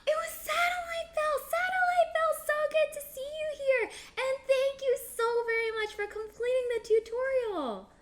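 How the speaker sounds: very high, loud excited squeal; singsong high-pitched shout-out to a viewer; thrilled greeting. Real, not acted. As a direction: gush at the top of your range. Streamer recognizes who joined, thanks them.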